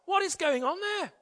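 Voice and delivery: very high, alarmed near-shout; acted panicky question, pitch way up; alarmed boss. A narrator's reading. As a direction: demand it in rising alarm, stopping short of shouting. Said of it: "Alarmed, the boss nearly shouted".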